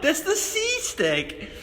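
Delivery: put-on high, slow drunken wail; drunk Sully voice. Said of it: "your Sully voice".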